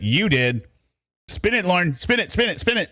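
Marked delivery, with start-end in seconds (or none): 1.3-2.9 higher, sing-song goading; mocking taunt. Real, not acted. Streamer goading Lauren with a mocking chant. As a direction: needle him, teasing.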